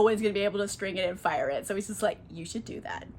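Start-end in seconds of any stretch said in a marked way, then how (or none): 2.3-3.0 low, soft retold suggestion; understated nudge; coaxing. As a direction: drop it in quietly, like a sly hint. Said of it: "talking her into it".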